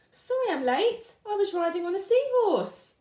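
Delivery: high, bright little fish voice; Tiddler excuse. Acted. Little fish apologising to the class in a high voice.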